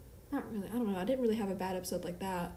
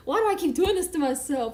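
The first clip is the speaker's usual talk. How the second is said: exasperated laughing self-talk; 7 semitones higher, 8 dB louder than usual. Retold or imitated. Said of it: "And I was just like".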